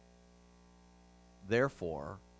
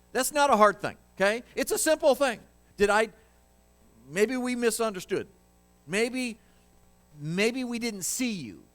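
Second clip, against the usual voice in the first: higher, louder, exasperated ranting king; Nebuchadnezzar freaking out; 8 semitones higher, 5 dB louder than usual. Acted. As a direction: rant like a furious tyrant. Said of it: "King freaks out".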